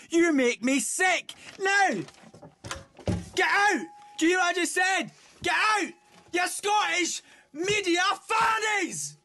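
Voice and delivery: high-pitched outraged yelling; enraged tirade at full pitch; furious shouting. Acted. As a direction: scream them out of the studio. Furious director throws actors out.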